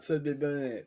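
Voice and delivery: muffled, as if full of marshmallows; mouth stuffed. Shown as a demonstration. Host demonstrating the marshmallow game, mouth stuffed. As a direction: garble it round a pretend mouthful. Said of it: "you put as many marshmallows in".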